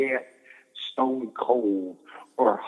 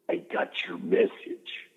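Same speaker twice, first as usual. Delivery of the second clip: slow, secretive whisper; hushed confiding whisper; 5 semitones higher, 5 dB quieter, slower than usual. Retold or imitated. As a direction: lean in and breathe it like a secret. Savage quoted in private room.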